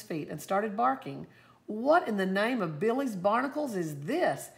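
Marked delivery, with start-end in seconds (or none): 1.7-4.5 put-on gruff old officer hollering in surprise; hollering old man. A narrator's reading. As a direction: holler at the barking dog, outraged. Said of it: "he hollered".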